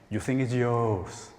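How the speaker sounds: normal talking voice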